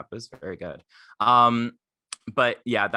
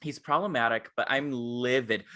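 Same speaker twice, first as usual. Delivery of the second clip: ordinary talk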